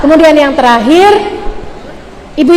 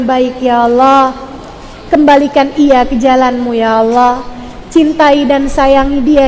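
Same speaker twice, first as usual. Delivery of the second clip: model prayer. Shown as a demonstration. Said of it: "kamu doanya kayak gini".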